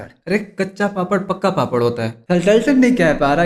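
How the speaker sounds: normal talking voice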